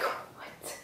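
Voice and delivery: barely audible, whispered what; stunned whisper. Acted. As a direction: whisper it in mock disbelief. Stunned reaction to man's words.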